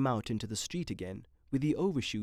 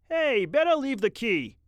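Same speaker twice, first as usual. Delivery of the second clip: high, louder gruff attendant; brash attendant; 11 semitones higher, 8 dB louder than usual. A narrator's reading. Attendant ordering Mitty out of the car, brash. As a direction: talk down to him like a brash lot hand.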